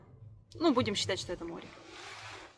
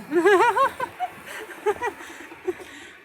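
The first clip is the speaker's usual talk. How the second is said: long, loud burst of laughter; 8 semitones higher, 7 dB louder than usual. Real, not acted. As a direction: laugh out loud, falling away. Laughs at her own joke.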